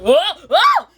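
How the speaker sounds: high, loud "oh, oh"; wobbling cries. Retold or imitated. Child's character loses balance while showing off, high loud cries. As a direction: cry out as if losing balance.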